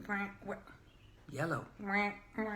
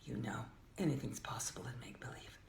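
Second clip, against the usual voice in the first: ordinary delivery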